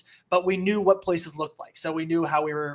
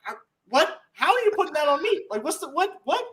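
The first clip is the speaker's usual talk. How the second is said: high-pitched flustered protest; imitated indignant squawk of innocence; mock disbelief; 9 semitones higher than usual. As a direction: sputter in baffled denial. Acted dad denying, taken aback.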